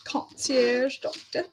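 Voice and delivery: concierge doctor said slowly in a put-on fancy accent, Concierge drawn out. Shown as a demonstration. Vlogger showing how fancy her new doctor sounds, mock fancy accent. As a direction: say Concierge doctor like a posh French word. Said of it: "You have to say it with an accent to make it more fancy".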